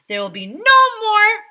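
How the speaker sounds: high, loud proclamation; mayor proclaiming loudly; loud decree. A narrator's reading. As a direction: boom out the rule. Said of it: "the mayor continued".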